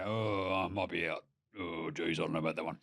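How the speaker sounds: low, muted mock reluctance; deflated doubt. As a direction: back out quietly, sinking into a low mumble. Retells doubts on seeing haircut.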